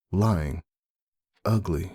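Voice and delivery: usual speaking voice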